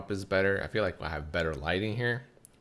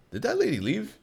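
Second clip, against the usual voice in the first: much higher, light imitation; mock cashier voice. Cashier returning with the bottle, high mock voice.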